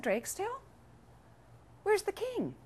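high, soft questioning voice; puzzled maid. Acted. Maid finds the duck wearing the crown, high puzzled voice. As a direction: lift the pitch lightly for a timid question.

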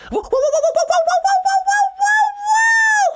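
very high, loud panicked whoa; panicked monkey. Acted. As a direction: shriek it like a monkey going under. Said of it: "said the monkey".

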